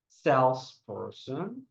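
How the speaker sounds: Salesperson said slowly with the stress on SALES; slow stress demo. Shown as a demonstration. Teacher models Salesperson for students to repeat, slow and stressed. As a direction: draw out Salesperson, leaning on the first syllable.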